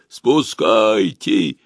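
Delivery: slow hollow boom from inside a hull. Acted. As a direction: drone it out, muffled and slow. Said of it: "прогудел внутри Архимеда".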